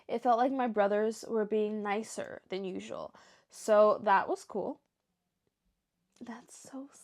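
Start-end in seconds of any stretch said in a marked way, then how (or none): 6.2-7.0 near-silent whisper of sympathy; moved and hushed. Real, not acted. Reader reacting to brothers being nicer, moved whisper. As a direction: whisper it, touched.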